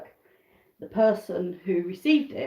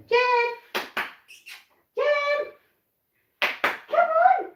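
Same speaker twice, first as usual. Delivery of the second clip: calling out; much slower than usual. Real, not acted. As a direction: sing out to bring her running. Calling hen over.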